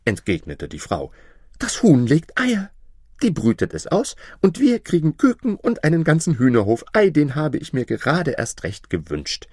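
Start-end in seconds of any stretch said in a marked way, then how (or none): 1.6-9.5 high-pitched happy housewife; admiring, delighted wife. Acted. Wife praises her husband for the sheep, delighted. Wife rejoices over hen.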